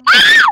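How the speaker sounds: very high piercing shriek of fright; terrified scream. Real, not acted. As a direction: shriek as it catches you. Caught by monster.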